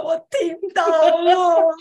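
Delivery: slow, loud, crying out; excited shout. Real, not acted. Excited realisation.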